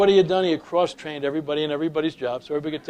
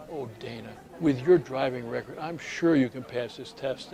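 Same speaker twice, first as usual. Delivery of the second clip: reassuring; 4 semitones lower, 8 dB quieter than usual. Retold or imitated. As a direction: gently comfort the nervous driver. Clerk pats hand, reassures.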